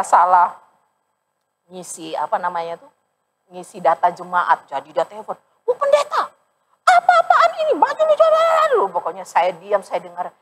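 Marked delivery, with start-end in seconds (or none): 5.6-8.8 put-on angry old man. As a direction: scold like a furious grandpa on the phone. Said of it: "pernah dimarah-marahin opa loh". (opa memarahi pendeta lewat telepon dengan suara tinggi)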